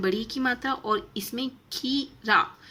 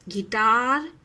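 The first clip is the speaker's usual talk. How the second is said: ordinary delivery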